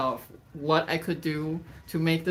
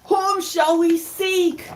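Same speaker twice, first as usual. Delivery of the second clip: high, loud, drawn-out cry; storybook mice crying out together; 13 semitones higher, 8 dB louder, slower than usual. A narrator's reading. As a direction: wail it out slowly as a plea. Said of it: "All mice then cry".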